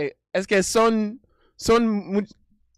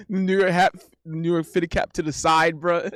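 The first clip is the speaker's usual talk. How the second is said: normal talking voice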